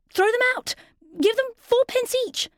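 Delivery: put-on high, fussy old king; a flustered king giving orders.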